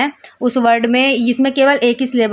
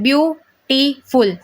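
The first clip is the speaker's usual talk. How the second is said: Beautiful as view, टी, फुल, clearly separated; gaps between syllables; much slower than usual. Shown as a demonstration. Teacher counting three syllables, a gap after each part of beautiful. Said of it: "आपको gap लेना पड़ रहा है".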